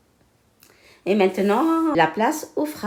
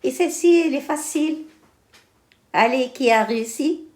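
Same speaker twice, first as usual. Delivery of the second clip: bright teacher voice; 7 semitones higher than usual. Real, not acted. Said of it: "teacher encourages the class".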